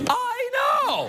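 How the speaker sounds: very high squeal of disbelief; mock amazement. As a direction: squeak it in hammed-up astonishment. Mock amazement at cup sales.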